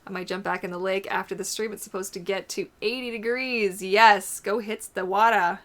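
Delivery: ordinary talk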